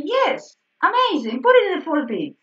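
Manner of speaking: high, loud burst of delight; acted enthusiastic approval. Teacher acting out approval of a composer's choice, enthusiastic. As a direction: cheer the idea on, pitched well up.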